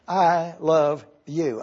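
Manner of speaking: "I love you" slowly, the word "I" stressed; slow, lean on "I" in "I love you". Shown as a demonstration. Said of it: "the emphasis is upon the personal pronoun".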